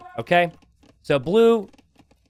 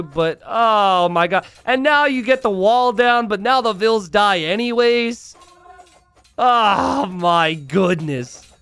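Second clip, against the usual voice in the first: higher, louder commentary in disbelief; excited play-by-play; 7 semitones higher, 5 dB louder, slower than usual. Acted. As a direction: narrate the chaos breathlessly.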